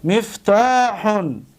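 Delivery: مفتاحون drawn out slowly; careful slow model of مفتاحون; slow pronunciation. Shown as a demonstration. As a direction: sound out مفتاحون for students. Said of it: "دقت بتید".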